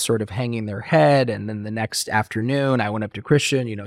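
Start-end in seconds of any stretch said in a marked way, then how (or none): none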